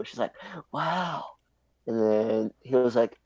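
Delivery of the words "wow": high, softer imitated wow; impressed classmate. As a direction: mimic a classmate's awe. Retelling the classmate's amazement.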